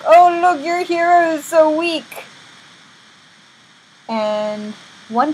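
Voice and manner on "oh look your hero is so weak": high, loud jeering at a fallen hero; hammed-up gloating; mocking taunt. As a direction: crow over a beaten captive. King mocks captive Samson, acted.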